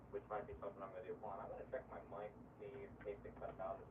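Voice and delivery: muffled mic, as through a wall; very quiet, muffled and distant. Shown as a demonstration. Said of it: "it just sounds like it's muffled".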